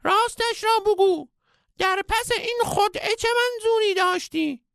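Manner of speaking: furious cartoon guru; sage shouting. A narrator's reading. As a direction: demand the truth in a shrill cry. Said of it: "پیر فریاد زد".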